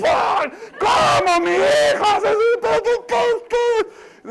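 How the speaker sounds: shocked shriek. Retold or imitated. Parent shrieking on hearing what the child did. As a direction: scream in outraged disbelief.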